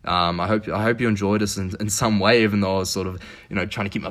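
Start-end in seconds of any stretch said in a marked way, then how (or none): none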